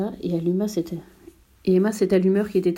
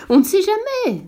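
much higher, louder pompous echo; the geographer seizes on the prince's words, pompous; geographer's echo; 12 semitones higher, 5 dB louder than usual. A narrator's reading. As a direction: repeat it with grand importance. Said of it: "dit le géographe".

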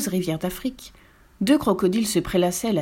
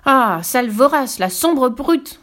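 loud, high, drawn-out indignation; furious storybook hippo; outburst of anger; 6 semitones higher, 6 dB louder, slower than usual. A narrator's reading. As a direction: flare up and stretch out each insult. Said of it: "s'emporta Double Croupe".

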